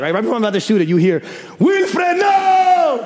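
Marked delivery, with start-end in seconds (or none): 1.6-3.1 sideline yell. Retold or imitated. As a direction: call out across the gym to stop him. Dad yelling from sideline.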